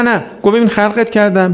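normal talking voice

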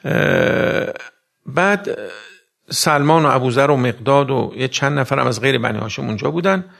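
a preacher loudly retelling Salim's report; preaching at full force. Acted. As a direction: declaim the account to the crowd. Loud sermon narration.

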